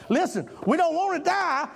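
high, urgent plea of the sailors; pleading mariners. Retold or imitated. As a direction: beg like a desperate sailor.

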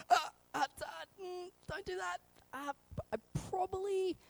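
high, soft, halting caution; hesitant warning. Acted. God in heaven watching people err, hesitant warning. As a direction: tentatively try to head them off with little uhs. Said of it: "God's sitting up in heaven going".

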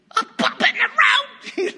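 incredulous squeal. Retold or imitated. [name] echoing his pastor's advice in laughing disbelief. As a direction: squeal it back like he can't believe it.

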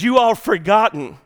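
loud preacher's rebuke; preaching. Acted. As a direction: start calling the church out. Preacher builds to loud climax.

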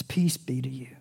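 a hushed, breathy whisper of comfort; whispered reassurance. Acted. Angel whispering comfort to the frightened shepherds.

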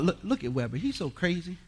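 quiet, hushed gossip voice; mocking gossipers. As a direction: mimic people whispering behind his back. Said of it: "Talking about".

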